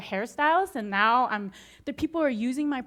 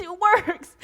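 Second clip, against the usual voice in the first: calling everyone, thrilled and higher; bursting with the news; excited; 8 semitones higher, 8 dB louder than usual. Retold or imitated. Calling everybody to share her news, excited. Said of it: "I was so excited".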